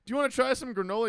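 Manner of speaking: high, loud, fast sales offer; hammed-up free-sample pitch; mock chirpy server. Acts out sample server in movie.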